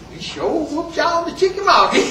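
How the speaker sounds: amused, high-pitched needling; gleeful old soldier. Acted. Old soldier taunts the colonel again.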